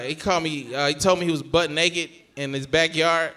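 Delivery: ordinary talk, nothing marked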